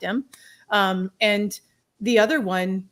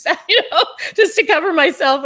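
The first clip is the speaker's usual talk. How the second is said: laughing; 8 semitones higher, 8 dB louder than usual. Real, not acted. Laughing at her own story.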